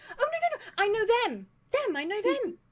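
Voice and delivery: tiefling spotting the party, very high and excited; excited recognition. Acted. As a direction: seize on the group like a lifeline. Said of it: "and she goes".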